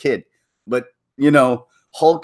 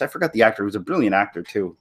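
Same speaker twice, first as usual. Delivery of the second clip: ordinary talk, nothing marked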